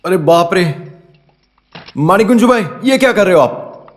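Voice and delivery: high-pitched dismayed exclaiming; alarmed. Acted. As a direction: call out in horror at what he is doing. Shocked at drunk man's mess.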